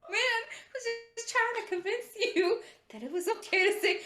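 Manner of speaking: retold protest in a high, squeaky pitch; high-pitched mock protest after cracking up; laughing protest. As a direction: imitate a backpedaling man, voice way up. Old man's protest, through laughter.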